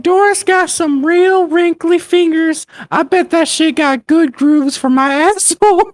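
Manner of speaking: put-on high, slow old lady reading; Doris old lady voice. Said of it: "read it in her old lady voice".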